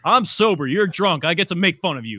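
high, loud comeback; loud bickering. Real, not acted. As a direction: fire back in a friendly squabble. Mock squabble over who is drunk.

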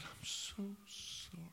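high, breaking whispery mock sob; sobbing apology. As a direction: imitate a boy sobbing out an apology, drawn out. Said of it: "he started sobbing".

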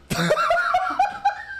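very high squealing laugh; shrieking laugh. Real, not acted. [name] losing it, very high shriek laugh. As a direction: burst out shrieking.

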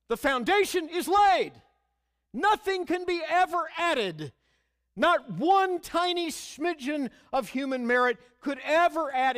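emphatic preaching. Acted.

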